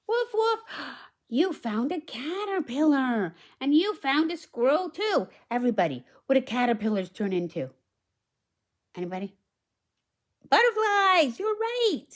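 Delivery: put-on bright, high read-aloud voice for the little girl; high storybook girl. Reads girl's lines.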